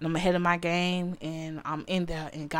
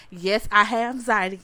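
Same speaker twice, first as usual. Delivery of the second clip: ordinary talk, nothing marked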